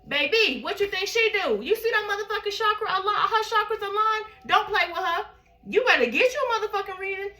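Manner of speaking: loud, high, hyped-up preaching about her reader; hyped praise. Real, not acted. Sister loudly hyping the reader to viewers, excited and loud. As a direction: hype her up to the crowd like a sermon.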